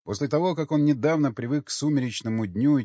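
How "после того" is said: ordinary delivery, nothing marked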